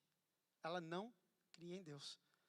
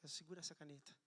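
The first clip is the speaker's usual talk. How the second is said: much softer, close and gentle; retold soft words; 3 semitones lower, 9 dB quieter, faster than usual. Speaking softly to his boss in a crowded room. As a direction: say it low so only she hears. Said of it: "Aí eu falei baixinho".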